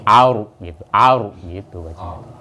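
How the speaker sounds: aur with a heavy, rounded ro; thick tafhim ro. Shown as a demonstration. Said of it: "Kayak tadi bibirnya gini". (ro tebal dengan bibir dibulatkan pada aur)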